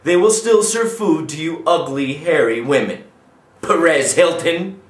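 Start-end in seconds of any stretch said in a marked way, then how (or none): none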